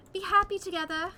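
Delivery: high, loud mocking send-off; sinister taunt. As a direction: taunt the dead couple cruelly. Killer's mocking farewell.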